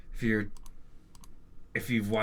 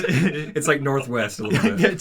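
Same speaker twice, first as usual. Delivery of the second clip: louder, laughing through the words; 5 semitones higher, 9 dB louder than usual. Real, not acted. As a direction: giggle while saying it. Joking about 3D hologram video.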